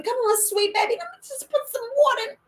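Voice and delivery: put-on sing-song it to a toddler; sweet baby talk. Grandma cooing at her grandbaby, high and quick. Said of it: "So let me".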